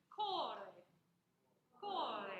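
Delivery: "core" as two syllables, a loud long "KO" then light "reh"; heavy first syllable. Shown as a demonstration. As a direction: hit the start of "core" hard. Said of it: "heavy on the first syllable".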